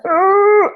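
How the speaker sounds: a loud, high strangled growl of frustration; frustrated groan. Retold or imitated. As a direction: let out a fed-up groan at the end of your rope. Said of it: "I'm a".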